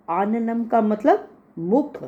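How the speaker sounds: ordinary talk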